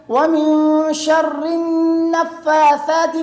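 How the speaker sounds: "شر النفاثات" joined with a long held n; correct tajweed. Shown as a demonstration. Teacher models the next verse, correct tajweed. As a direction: chant "ومن شر النفاثات" very slowly. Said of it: "اسی طرح آگے".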